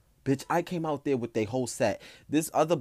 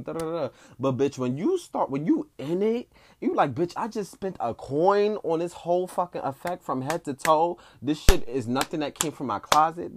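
higher, heated imitation of an outraged contestant; hammed-up indignant rant, pitched up; retold chop rage; 5 semitones higher than usual. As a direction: rant like you paid for all of it.

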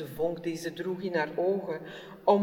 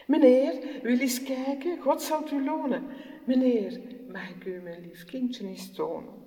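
raised, pleading mother voice; humble pleading mother; 6 semitones higher, 4 dB louder than usual. Acted. Mother begs the workman.

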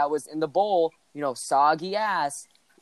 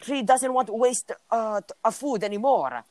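higher, broken-English imitation of a foreign friend; hammed-up immigrant-kid voice telling his story; friend's foreign accent; 6 semitones higher than usual. Guy imitating his friend from a foreign land telling about cereal, mock accent.

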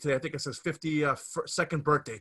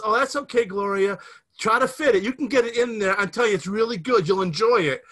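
lightly voiced coaxing ad man, higher and louder; guy in the commercial; 5 semitones higher, 4 dB louder than usual. Retelling the ad, the man coaxing Gloria, mock smooth. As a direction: coax her along like a cheesy radio actor. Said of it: "then the guy says".

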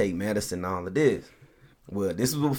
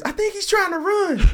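high, laughing neighbour's call; laughing mock shout; 4 dB louder than usual. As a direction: squeal it out through laughter.

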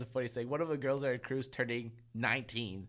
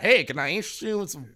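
mock cowboy dealer; 3 semitones higher, 6 dB louder than usual. Mock cowboy offering pills.

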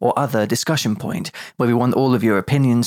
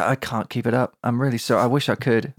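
usual speaking voice

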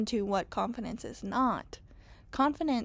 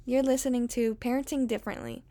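podcast intro; 2 semitones higher than usual. Acted. Recorded podcast intro over music.

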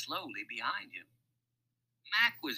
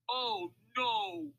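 high-pitched crook voice, dismayed; 11 semitones higher than usual. A narrator's reading. Louis learns the cargo is candy, dismayed crook.

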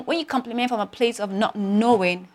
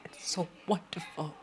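much softer, hushed and moved; tender, awed praise; 2 semitones lower, 15 dB quieter than usual. Retold or imitated. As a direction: breathe it to the artist, almost in tears. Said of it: "I'm like".

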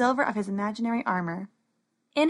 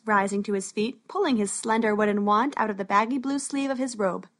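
usual speaking voice

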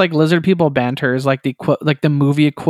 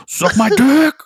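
high taunting gamer voice; bratty gamer taunt; 10 semitones higher, 3 dB louder than usual. Retold or imitated. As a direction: squeak out the insult. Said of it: "Okay, guys, here's the boss".

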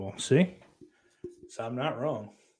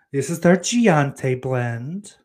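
slow, louder coffee-ad presentation, rising at the end; mock coffee pitch; 3 semitones higher, 6 dB louder, much slower than usual. Streamer pitching a special coffee blend, mock ad voice. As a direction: present the Giante blend with drawn-out showmanship. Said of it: "sounds like a special coffee".

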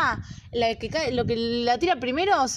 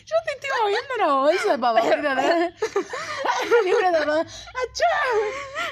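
laughing; 9 semitones higher, slower than usual. Real, not acted. Laughing while rustling chips bag.

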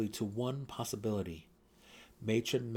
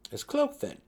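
much higher, louder sudden thought; sudden realization; 8 dB louder than usual. A narrator's reading. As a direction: it dawns on him suddenly. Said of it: "he wondered".

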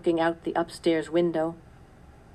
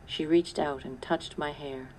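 ordinary delivery

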